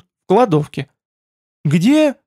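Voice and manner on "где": high, startled question; startled outburst. A narrator's reading. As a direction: blurt it out in alarm. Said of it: "скинулся владыка Хоргов".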